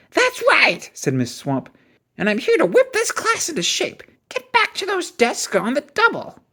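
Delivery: very high, scratchy, commanding; scratchy witch voice. A narrator's reading. Viola Swamp whips the class into shape, scratchy and stern. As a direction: bark orders like a strict witch.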